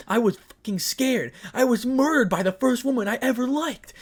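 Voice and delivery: high, loud anguished cry; a wounded lover screams about being killed; anguished yell. Acted. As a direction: let the fear burst out. Issei's anguished outburst.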